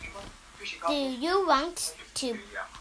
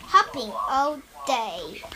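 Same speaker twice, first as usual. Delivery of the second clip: plain reading voice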